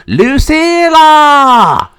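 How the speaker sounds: long drawn-out high calls; shouting a name. Acted. Troubled brother shouting for his sister, calling out. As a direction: holler far and high for someone missing. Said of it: "who was shouting their sister's name".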